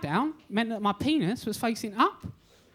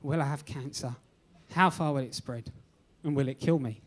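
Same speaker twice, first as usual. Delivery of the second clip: anxious thoughts; 9 semitones lower than usual. Retold or imitated. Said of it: "I've been thinking".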